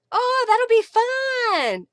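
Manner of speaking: high, loud, drawn-out delight; excited. Real, not acted. Crafter reacting to a viewer's crafty group, excited. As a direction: gush it out with long, high vowels.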